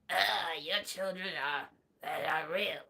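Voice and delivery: low, soft mock Strange voice; a hint of the sorcerer's gravity; understated low Doctor Strange; lightly voiced Doctor Strange. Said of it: "He doesn't say it like that".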